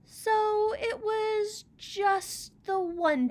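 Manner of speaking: high, slow and teasing; a tiny bird poking fun; hummingbird voice. Acted. Hummingbird teasing Old Rock, high and slow. Hummingbird questions the single flight.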